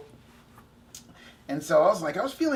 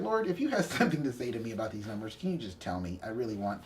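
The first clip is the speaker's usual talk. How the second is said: hushed prayer; 6 semitones lower, 7 dB quieter, faster than usual. Retold or imitated. Quoted prayer to God.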